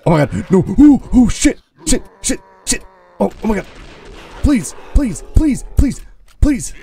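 high, frantic begging; real panic, squeaking up high; panicked pleading. Real, not acted. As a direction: beg for your life as they close in. Narrowly surviving a zombie horde.

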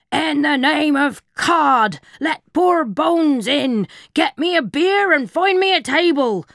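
rasping, gasping pirate voice, loud; gravelly gasping pirate. A narrator's reading. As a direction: gasp it out like gravel in a tin. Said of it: "gasped a voice like gravel in a tin".

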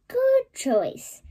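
shopkeeper voice. Acted. Mister Fox voiced.

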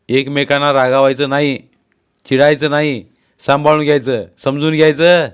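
loud, stern fatherly rebuke; shouted scolding. A narrator's reading. Father breaking up a couple's quarrel, shouting. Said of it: "तसे बाबा ओरडले".

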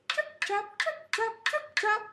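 high, short rhythmic hoof beats; hoof sounds. Acted. Storyteller making little goat's hoofs go, high. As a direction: chant each step high and crisp. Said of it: "the Billy Goat goes".

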